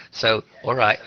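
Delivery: normal talking voice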